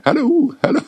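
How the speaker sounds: high, far-off voice calling hallå; little man calling. Retold or imitated. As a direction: play the little man knocking at the door. Mimics little man knocking in.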